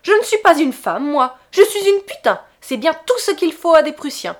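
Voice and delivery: loud, bitter vehement retort; indignant, vehement. A narrator's reading. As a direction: hurl it at him in indignation. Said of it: "indignée et véhémente".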